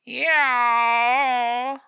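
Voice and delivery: long, loud, high yowl; cat yowl. A narrator's reading. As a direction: hold a challenging yowl. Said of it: "was the yellow answer".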